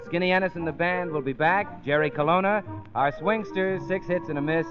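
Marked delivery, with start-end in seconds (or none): none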